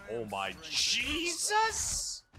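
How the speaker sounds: high, slow, stretched-out gasp; high drawn-out shock. Real, not acted. Reaction to chaos.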